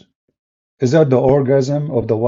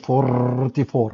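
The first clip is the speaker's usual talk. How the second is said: put-on "Forty-four" drawn out slowly, Filipino accent; slow accented repeat; 4 dB quieter, much slower than usual. Bus conductor repeating the amount on request, slow Filipino accent. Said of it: "say it one more time".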